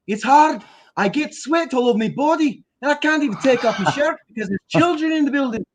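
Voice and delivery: hammed-up Scottish groundskeeper complaining; aggrieved Scottish workman; Scottish accent. Shown as a demonstration.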